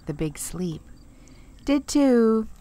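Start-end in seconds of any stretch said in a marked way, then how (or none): 1.7-2.5 loud, higher tit-for-tat comeback; childish retort. A narrator's reading. Bertie fires back at Teddy with a loud childish retort. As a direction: snap back like a stubborn kid. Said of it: "countered Bertie".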